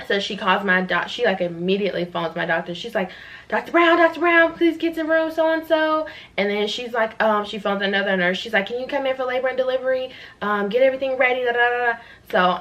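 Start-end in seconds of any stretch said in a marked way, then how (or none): 3.5-6.1 high sing-out hospital page; nurse calling out. Retold or imitated. Nurse urgently phones the doctor to the delivery room, high calling-out voice. 8.7-12.0 nurse on phone. Retold or imitated. Nurse phoning another nurse for help.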